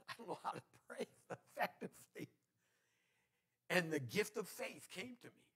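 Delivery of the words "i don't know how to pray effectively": real laugh through the words. Real, not acted. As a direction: chuckle at yourself while admitting it. Laughs at his own helplessness.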